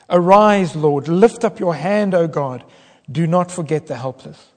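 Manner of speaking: loud, urgent plea to God; urgent pleading cry. Acted. Preacher crying out the psalm to God. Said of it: "you could mark the change in David's attitude".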